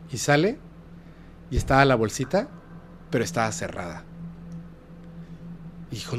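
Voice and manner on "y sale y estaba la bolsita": ordinary delivery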